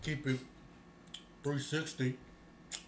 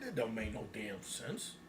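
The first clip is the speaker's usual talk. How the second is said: usual speaking voice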